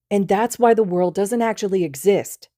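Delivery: loud, high triumphant finish; proud conclusion. Acted. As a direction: wrap up your theory proudly. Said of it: "Midoriya proudly concludes".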